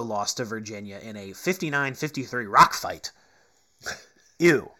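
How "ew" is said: loud grossed-out ew; disgusted. Real, not acted. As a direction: recoil at something gross. Ugly 59-53 score read out.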